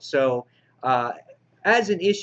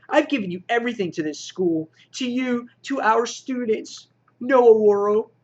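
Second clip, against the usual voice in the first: higher-pitched mock whiny tantrum; whiny baby; 7 semitones higher, 4 dB louder than usual. X-Man complaining to her teammates about the school, mock whiny. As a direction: act out a whiny, hammed-up complaint. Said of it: "She's like a little whiny baby here".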